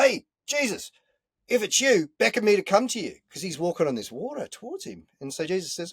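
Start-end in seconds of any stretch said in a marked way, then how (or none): 0.0-3.2 yelling out. Retold or imitated. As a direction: shout across the lake to him. Peter yelling to Jesus on water.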